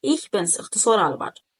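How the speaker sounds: ordinary talk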